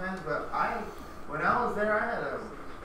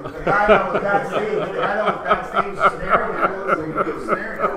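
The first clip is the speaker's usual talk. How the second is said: louder, laughing through the words; 4 semitones higher, 12 dB louder than usual. Real, not acted. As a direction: correct them while laughing. Laughing while correcting listener.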